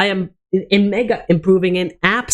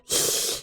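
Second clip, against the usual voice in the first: exasperated sigh; 9 dB quieter than usual. Real, not acted. Exasperated after teen edginess.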